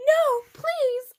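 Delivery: high, pleading cry; hammed-up desperate plea; mock begging. A narrator's reading. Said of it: "I begged".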